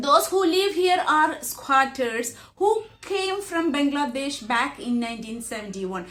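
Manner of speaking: slow, careful read-aloud of the English passage; measured classroom reading; slow textbook reading. Acted. Reads the next text line.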